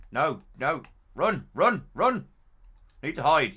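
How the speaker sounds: real loud panic while fleeing; panicked. Real, not acted. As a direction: scramble away in panic. Fleeing monster in game.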